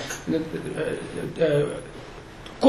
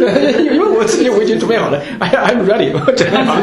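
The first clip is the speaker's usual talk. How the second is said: laughing; 5 semitones higher, 4 dB louder than usual. Real, not acted. Jokingly claims own readiness, laughing.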